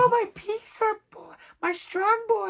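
overdone fake Spanish accent, high and breathless-sounding; mock Spanish woman greeting the pizza boy, high fake accent; bad Spanish accent. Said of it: "really bad Spanish accent".

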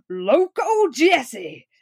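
very slow, drawn-out outlaw introduction; grand, lingering western billing; dramatic announcement. Pretend. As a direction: stretch each word for a mock-ominous reveal.

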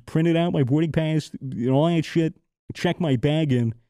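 ordinary delivery

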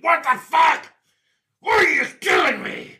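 high shouted outrage, then a slow hurt wail; betrayed monster. Acted. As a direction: scream betrayal at the one who shot him. GM voices wounded monster.

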